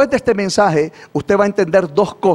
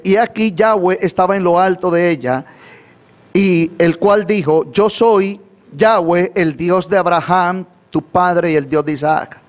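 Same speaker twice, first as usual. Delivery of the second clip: slower repeat. Shown as a demonstration. Preacher repeating the verse for the congregation, slowly. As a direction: read the verse again slowly, word by word. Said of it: "Lo voy a repetir más despacio".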